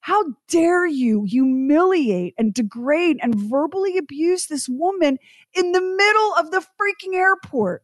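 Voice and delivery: rising, high-pitched indignation; outraged. Retold or imitated. Storyteller rails at the man who yelled at his wife, outraged. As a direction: build to a furious peak.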